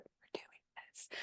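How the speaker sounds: unvoiced whisper, low and firm; whispered vow. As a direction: breathe it out like a secret vow. Quiet vow to husband.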